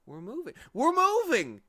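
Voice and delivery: building from excited to loud joyful shout; happy shout. Acted. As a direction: cheer it out in delight. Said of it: "he shouted happily".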